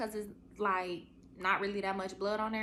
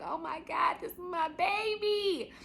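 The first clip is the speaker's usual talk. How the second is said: much higher and louder, full of wonder; awed joy; 11 semitones higher, 4 dB louder than usual. Retold or imitated. Mother seeing her newborn's face, overjoyed. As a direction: gasp it at your newborn. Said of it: "and I'm like".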